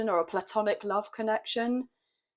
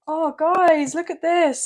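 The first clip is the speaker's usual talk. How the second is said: excited; 6 semitones higher, 8 dB louder, slower than usual. Real, not acted. Tarot card reveal excites her.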